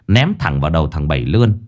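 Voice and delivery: usual speaking voice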